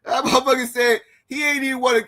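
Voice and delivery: high giggly voice through laughter; can barely get it out for laughing. Real, not acted. Moderator cracks up retelling Rio's joke, high and giggly.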